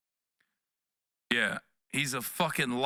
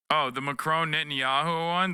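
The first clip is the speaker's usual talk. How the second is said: usual speaking voice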